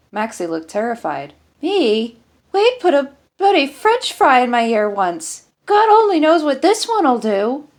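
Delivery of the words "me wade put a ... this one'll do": much higher and louder, alarmed pleading; panicked protest. Acted. Maxie protests being moved.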